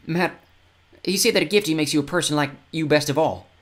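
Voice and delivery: put-on high, rough-spoken fen boy; scruffy young boy. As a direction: tell it like a grubby little kid. Tyke voice again.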